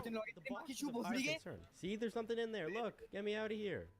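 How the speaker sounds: lightly voiced high plea from inside the box; mock trapped voice. Goose stuck in a box, begging to be let out, mock high plea. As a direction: squeak it out like someone stuck in a box.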